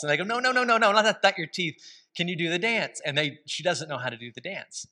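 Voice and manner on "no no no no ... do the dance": put-on higher, bouncy kid insistence; quick no-no-no, like excited little sisters; eager kids. Kid sisters correcting Nana about the floss, eagerly.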